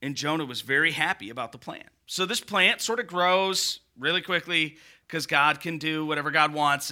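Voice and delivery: ordinary delivery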